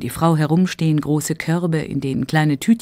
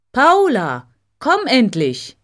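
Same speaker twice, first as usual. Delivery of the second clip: louder and higher impatient call; 7 semitones higher, 5 dB louder, slower than usual. Acted. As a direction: call her across the market, fed up. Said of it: "ruft Karl".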